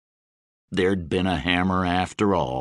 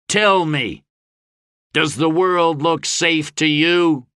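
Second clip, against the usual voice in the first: high, raised question; challenging oratory; 7 semitones higher, 4 dB louder than usual. A narrator's reading. Gabe pointing at the horde, raised challenge. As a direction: dare the crowd to answer.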